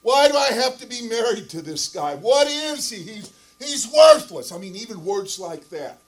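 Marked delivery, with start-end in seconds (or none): 0.0-4.5 loud imitation of a wife's distressed outburst; retold bawling. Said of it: "she's just bawling, and she's crying".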